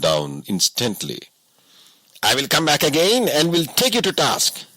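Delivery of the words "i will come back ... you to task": lightly voiced boss, hollow bluster; empty threat. A narrator's reading. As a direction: bluff while backing off. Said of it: "he said emptily".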